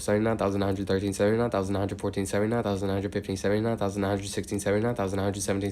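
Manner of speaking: steady, rhythmic number chant; monotone counting. Real, not acted. Counts aloud toward eighty thousand.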